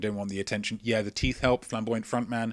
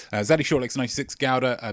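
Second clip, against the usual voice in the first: usual speaking voice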